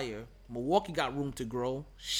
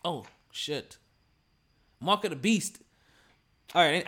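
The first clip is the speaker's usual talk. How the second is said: normal talking voice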